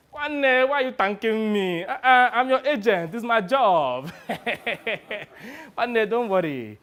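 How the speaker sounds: high breezy protest with a chuckle; Sonny, jovial. Acted. Agent brushes off the thanks.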